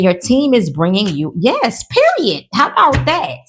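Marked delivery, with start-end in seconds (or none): 1.4-2.5 high, slow, emphatic yes; excited. Real, not acted. Reader thrilled as the cards confirm her call. 2.5-3.4 put-on a touch of her old New Orleans accent, high and bright; Nola accent. Shown as a demonstration. Tarot reader slipping into her old Nola accent. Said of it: "how about that in my old Nola accent".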